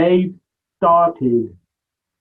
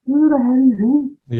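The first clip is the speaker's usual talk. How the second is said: higher, a little louder, half-mocking who-is-he; incredulous outburst; 13 semitones higher, 4 dB louder than usual. Retelling the stage manager spotting a stranger, mock astonished. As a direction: toss it out in amazed disbelief.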